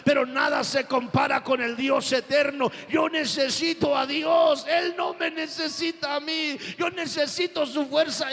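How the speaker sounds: loud, high shouting sermon climax; preaching at full force. Acted. Preacher crying out to the congregation that he needs God, shouting. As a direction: build to a ringing shout of faith.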